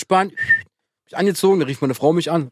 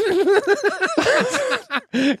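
long high-pitched cackle; laughing way up high. Real, not acted. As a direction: crack up in a squeal. Laugh after song joke.

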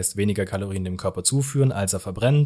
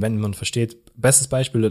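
usual speaking voice

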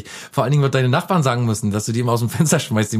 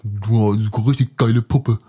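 leering guy; slower than usual. Pretend. Voicing a leering man at photo.

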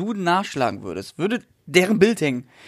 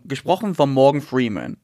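ordinary talk, nothing marked

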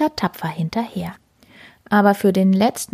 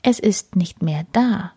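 plain reading voice

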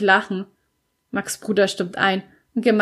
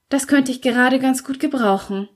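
usual speaking voice

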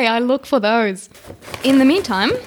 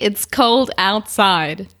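slow dramatic reveal; 4 semitones lower, much slower than usual. Acted. Dramatic reveal of song title.

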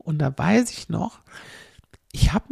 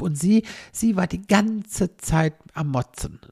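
ordinary talk

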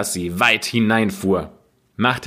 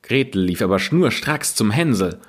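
ordinary delivery

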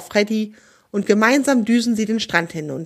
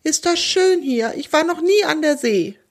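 tearful sob; 7 semitones higher than usual. A narrator's reading. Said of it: "Lucky fängt an zu schluchzen".

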